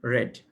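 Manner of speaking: রেড said like the colour "red"; short "e" in রেড, voice dropping; past-tense pronunciation. Shown as a demonstration. Teacher answers how it is pronounced, short-e রেড. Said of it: "কি উচ্চারণ হয় মনে রাখবেন?".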